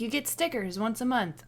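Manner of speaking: normal talking voice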